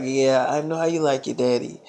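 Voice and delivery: low, hushed imitated flirting; retold sweet-talk, dropped very low and soft.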